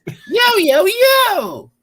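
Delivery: very high, squeaky robot "yo"; Alpha Six impression. Host mimicking a robot that talks like rap videos, super high-pitched. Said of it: "it's super high-pitched".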